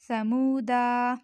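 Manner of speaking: समूदा drawn out, dal with only a short a; slow recitation demo. Shown as a demonstration. Teacher introducing the next word slowly. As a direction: read समूदा slowly and clearly. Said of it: "इसे पर क्या पढ़ा जाएगा".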